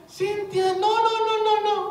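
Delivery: high, loud mother's scolding; alarmed mother. Retold or imitated. Mother stopping Cynthia feeding the fish, high loud alarm. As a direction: stop the child sharply in a high mother voice. Mother stops child at aquarium.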